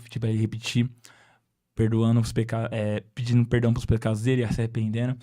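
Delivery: usual speaking voice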